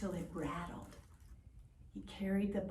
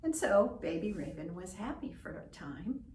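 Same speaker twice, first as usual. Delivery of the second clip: plain reading voice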